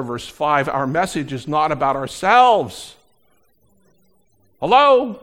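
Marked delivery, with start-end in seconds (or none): 4.6-5.2 high, loud rhetorical hello; loud call out. Real, not acted. Preacher calls out to congregation.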